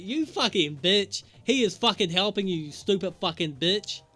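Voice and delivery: mock angry insult. Voicing Ellie's angry stank face.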